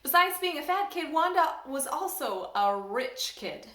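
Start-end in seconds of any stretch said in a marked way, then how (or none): none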